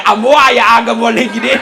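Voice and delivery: ordinary talk, nothing marked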